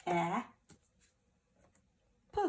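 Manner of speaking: "A" as short a, long pause, then "p"; "A", "p" as two separate letter sounds; sounding out. Shown as a demonstration. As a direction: isolate "A" and a puffed "p". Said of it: "First syllable".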